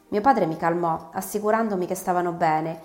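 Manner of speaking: ordinary delivery, nothing marked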